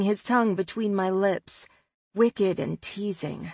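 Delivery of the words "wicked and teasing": ordinary delivery, nothing marked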